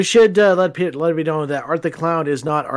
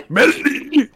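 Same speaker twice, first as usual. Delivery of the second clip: creepy calling voice; 9 semitones higher, slower than usual. Pretend. Creepy calling name game.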